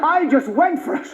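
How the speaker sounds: usual speaking voice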